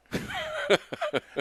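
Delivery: high burst of real laughter. Real, not acted. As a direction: laugh out at the punchline. Laughing at his own story.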